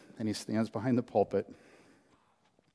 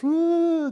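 a high, drawn-out mock wail of bawling; 5 dB louder than usual. Pastor imitating the young man bawling in Bob Evans, high mock wail. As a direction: imitate a grown man bursting into sobs. Imitating the man's wailing bawl.

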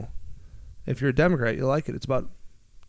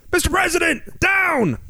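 high, urgent agent call; Secret Service shout; 12 semitones higher than usual. Retold or imitated. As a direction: bark it like a bodyguard. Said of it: "they'd say".

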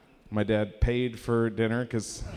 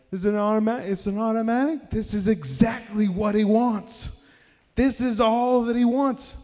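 high, giddy mock excitement; excited discovery; 10 semitones higher, 3 dB louder than usual. Family inspecting dad's dream Porsche, acting giddy. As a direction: act out amazed, high-pitched checking.

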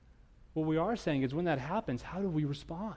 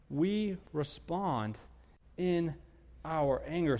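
very slow, pausing between words; drawn-out emphasis; 2 semitones higher, much slower than usual. Acted. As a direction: let each word land with weight. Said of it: "drawn-out sermon punchline".

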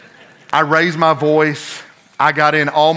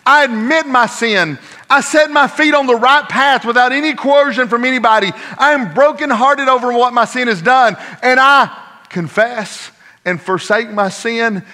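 high, driving pulpit delivery; impassioned preaching; 10 semitones higher, 7 dB louder than usual. Real, not acted. Preacher explaining repentance to his congregation, impassioned preaching. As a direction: preach it at full force, pitch held high.

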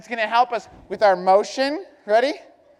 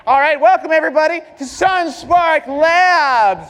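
big loud showman greeting, raised pitch; booming welcome; 3 semitones higher, 7 dB louder than usual. Real, not acted. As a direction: shout the welcome to the whole room. Host greets crowd of kids.

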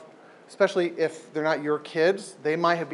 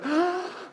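caught gasp; 13 semitones higher, 3 dB quieter than usual. Acted. Son sucking it up.

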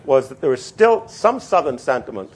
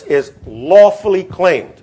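"lawfully" punched higher, louder and drawn out; stress on lawfully; 6 semitones higher, 6 dB louder, slower than usual. Shown as a demonstration. Lecturer quoting a clause to his class, emphatic on "lawfully". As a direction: read it slowly, leaning hard on "lawfully". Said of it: "I want to emphasize the word lawfully".

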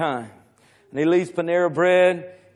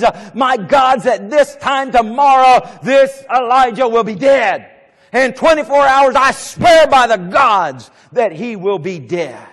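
loud, higher, slow furious vow; irate Jezebel; 6 semitones higher, 8 dB louder than usual. Acted. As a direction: swear his death like an enraged queen. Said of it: "And she was irate".

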